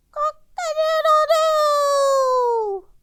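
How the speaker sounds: long, very high, loud cock-a-doodle-doo; rooster crow. Shown as a demonstration. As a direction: crow like a proud rooster at dawn. She demonstrates the rooster's crow.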